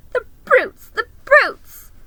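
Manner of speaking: very high, loud sobbing cry; tearful outburst. Acted. Lucy crying at the creatures, very high and loud. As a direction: spit it through tears. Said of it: "tears streaming down her cheeks".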